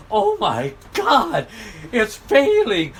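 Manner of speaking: high, slow, laughing mock delight; mock a billionaire laughing at bad news; slow, high imitation chuckling with glee; gleeful mock Gates. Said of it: "He looks so darn happy".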